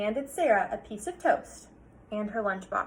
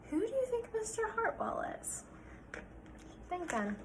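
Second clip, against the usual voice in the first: higher whispered question; 10 semitones higher, 10 dB quieter than usual. Real, not acted. Teacher whispering a question to the class.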